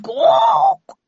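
loud, high squawk; high startled animal cry; startled creature cry. A narrator's reading. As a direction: squawk out in alarm like a startled bird-beast. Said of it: "a startled cry of".

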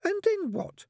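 put-on very high child's voice; squeaky, insistent question; demanding little girl. A narrator's reading. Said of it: "demanded the suspect".

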